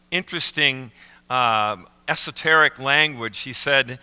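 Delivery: ordinary talk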